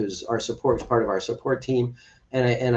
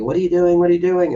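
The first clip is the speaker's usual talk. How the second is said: raised, higher mock yell of a panicking son; retold yell; 6 semitones higher, 5 dB louder than usual. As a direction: replay the son's alarmed shouting. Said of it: "he's yelling at me".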